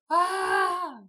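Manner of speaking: high, loud acted "ah" scream; mock scream. Recapper voicing Liddy's reaction to the attack, mock scream. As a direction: imitate a character crying out in alarm. Retelling a frightened character's scream.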